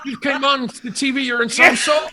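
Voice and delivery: high, loud, incredulous director; amazed, high-pitched impression; John Landis. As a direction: shout it in amazement, imitating the director. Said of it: "he went".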